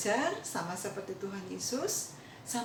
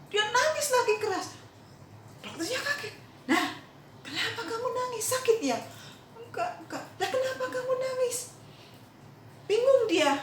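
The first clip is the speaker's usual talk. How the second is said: much higher, dramatic storytelling voice; excited puzzled telling; 13 semitones higher than usual. A narrator's reading. Preacher voicing the confused doctor asking why, raised high. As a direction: pitch it up high as the baffled doctor.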